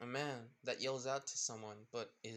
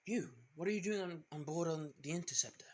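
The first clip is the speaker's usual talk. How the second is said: startled challenge; 5 semitones higher, faster than usual. Acted. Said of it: "the man saying".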